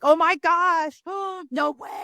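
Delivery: high, gasping mock amazement; mock gushing fan. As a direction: play the starstruck fan flattering the host. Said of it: "goes up when you're like".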